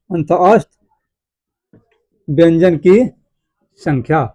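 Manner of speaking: broken into pieces with very long pauses; slow dictation. Shown as a demonstration. Teacher dictating key points to students, halting for writing. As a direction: leave time to write after each piece.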